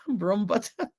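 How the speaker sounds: Broombuttock spoken slowly through a laugh; laughing try. Shown as a demonstration. Trying the cousin's town name, laughing through it. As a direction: giggle while you say Broombuttock. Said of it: "learn how to pronounce that".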